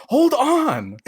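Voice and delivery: dad pretending he's coming, with a huffy high voice; mock annoyed brush-off. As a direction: play the dad fobbing her off, high-pitched. Dad brushing off mom's request.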